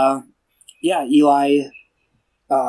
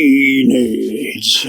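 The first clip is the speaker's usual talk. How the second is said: long drawn-out single word; hammed-up, drawn-out film quote; movie quote impression. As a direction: stretch it out theatrically. Quoting the movie's famous drawn-out word.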